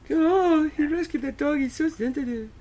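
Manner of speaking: very high swooning voice; cooing woman. Retold or imitated. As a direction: swoon over him breathlessly. Mocking women cooing over dog.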